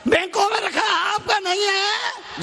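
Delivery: high, insistent preaching cry; emphatic proclamation. Real, not acted. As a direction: drive it home at a high pitch. Same point proclaimed to crowd. (ऊँचे सुर में ज़ोर देकर)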